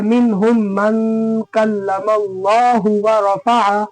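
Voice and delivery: slow, measured chant with held long vowels; Quran recitation. Shown as a demonstration. Teacher reciting the verse for students, slow measured chant. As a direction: recite slowly and evenly, drawing out long syllables.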